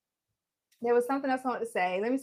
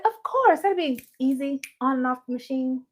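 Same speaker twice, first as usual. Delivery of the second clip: high, louder breezy yes; eager agreement; 4 semitones higher, 6 dB louder than usual. Retold or imitated. Host retelling how she told a customer it's easy, eager agreement. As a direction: start way up high and settle into it. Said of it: "I was like".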